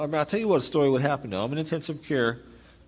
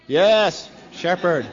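proud exclamation; 7 semitones higher, 9 dB louder, much slower than usual. Real, not acted. Proud naming of the clinic.

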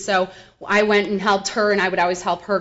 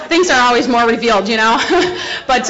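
ordinary talk, nothing marked

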